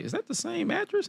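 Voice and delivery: high, hushed mock confusion; small high-pitched baffled voice; puzzled self-quote. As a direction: squeak the question softly in disbelief. Acts out confused reaction to screen.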